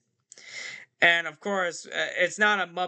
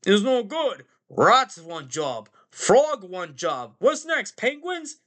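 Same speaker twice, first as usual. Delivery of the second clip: put-on diner owner, louder and drawn out, rising on the question; Pete; 4 semitones higher, 4 dB louder, slower than usual. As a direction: play an exasperated boss listing staff, ending high. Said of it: "And Pete's like".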